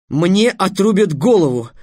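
high, loud, slowed exclamation; exclaiming. A narrator's reading. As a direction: cry it out as a sudden answer. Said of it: "воскликнул Трикс".

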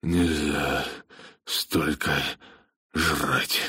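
halting, worn-out self-reproach; sighing, exhausted. A narrator's reading. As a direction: sigh out the words one by one. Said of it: "Он вздохнул".

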